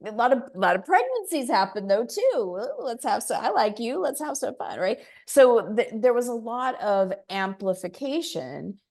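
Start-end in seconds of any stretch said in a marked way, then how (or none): 2.6-4.8 higher playful flirt; flirty mock. Mocking flirting couples in lockdown, high and playful.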